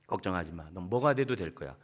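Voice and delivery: calm a disappointed son; gentle reassurance. Shown as a demonstration. (실망한 아들을 다독이는 부모, 낮고 부드럽게)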